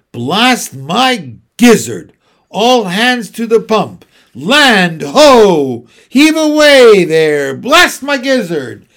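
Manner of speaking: slow, loud raging admiral; bellowing old sailor. A narrator's reading. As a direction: roar it like a furious sea captain. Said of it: "shouted the Admiral".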